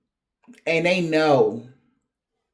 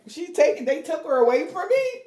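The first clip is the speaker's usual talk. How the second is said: high, frantic mock panic; hammed-up disbelief at losing her; panicked disbelief; 10 semitones higher than usual. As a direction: act stunned, voice jumping up. Panicked lover voiced.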